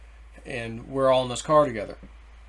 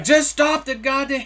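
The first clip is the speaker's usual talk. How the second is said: high-pitched retold outburst; strained, high angry plea; getting mad; 11 semitones higher, 4 dB louder than usual. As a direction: re-enact losing your temper at the driver. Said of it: "I'm getting mad".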